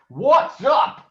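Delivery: high, loud angry growl. Acted. Father angry at his son, growling. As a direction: bark it at your son. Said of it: "growled Two Ton".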